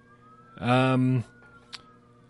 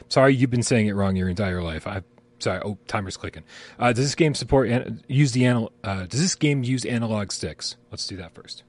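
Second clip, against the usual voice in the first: normal talking voice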